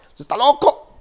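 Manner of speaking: high, loud mock yell of the disciples; imitated shouting. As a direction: yell it across like an alarmed onlooker. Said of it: "los discípulos le gritaban".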